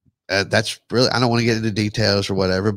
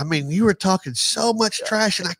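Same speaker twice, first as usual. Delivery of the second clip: high, cocky taunting voice; cocky trash talk; 10 semitones higher than usual. Pretend. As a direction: boast over your rival. Playful golf trash talk.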